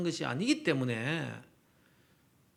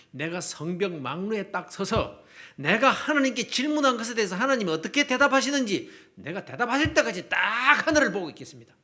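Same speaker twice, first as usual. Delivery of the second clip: 힘주어 버티는 예언자의 말투; 점점 목소리를 키우며 물러서지 않겠다고 선언하기; 당돌한 선언; 3 semitones higher, 4 dB louder than usual. Acted. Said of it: "이러면서 당돌하게 나옵니다". (당돌하게 맞서는 큰 목소리)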